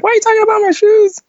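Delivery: very high, quick mimicry of an offended girl; mock indignant girl. Girl teased about her sneakers protests, high mock-indignant imitation. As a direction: squeal it like a mocked, protesting girl.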